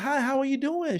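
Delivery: high, light newcomer's greeting; acted chirpy small talk; polite high reply. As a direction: answer in a put-on friendly high voice.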